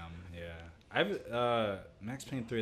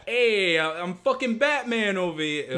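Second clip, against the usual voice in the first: put-on Jersey accent sliding into Boston, louder and higher; attempted Jersey accent; 9 semitones higher, 6 dB louder than usual. Friend tries Jersey accent for Batman, drifting toward Boston. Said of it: "what's a Jersey accent".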